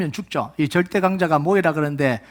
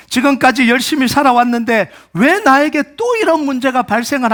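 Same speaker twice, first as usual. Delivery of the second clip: louder, higher pleading with God; impassioned complaint, pitched up; anguished protest; 5 semitones higher, 4 dB louder than usual. Acted. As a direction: cry out a frustrated prayer. Acting an anguished prayer of protest.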